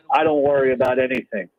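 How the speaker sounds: ordinary delivery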